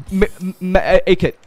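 normal talking voice

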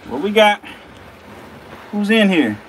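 calling high and loud, teasing, like knocking on a door; a mock-friendly hello into the enclosure; playful call into the cage. Real, not acted.

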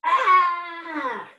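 high, drawn-out "Ah!" shriek; sudden high yelp, falling at the end. A narrator's reading. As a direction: startle them with a storybook scream. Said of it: "shrieked Little Yellow Dog".

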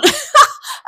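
high giggling burst; amused laugh. Real, not acted. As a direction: burst out laughing mid-story. Amused by the remembered quiz.

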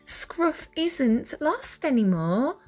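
ordinary delivery, nothing marked

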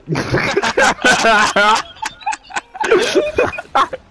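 loud laughing. Real, not acted.

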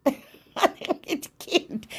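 giggling. Real, not acted. Giggly aside about her craft.

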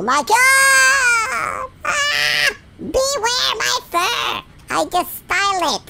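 high, drawn-out squealing cry; mock panic shout. Vain puppy fearing the lasers will ruin his fur, high mock shriek. Dog character facing lasers.

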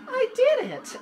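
high, loud squeal of triumph; thrilled victory yelp; excited cheer. Real, not acted. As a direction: burst out in real delight. Gleeful win while playing.

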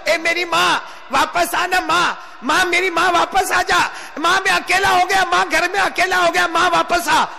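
high, plaintive calling after the dead; pleading lament. Acted. Son calling behind his mother's funeral, pleading lament. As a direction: act out a bereft son's plea.

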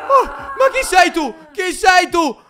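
high, loud shocked cry; startled shout. Real, not acted. Gamer yelling in shock at a zombie. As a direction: shout in fright at a stranger.

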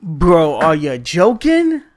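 loud disbelief. Real, not acted. Reacting to game setback.

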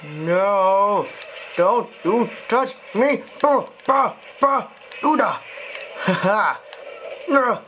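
panicked yelling. Real, not acted. Player yelling at an enemy grabbing him, high frantic shouts. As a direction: shriek at the attacker to back off.

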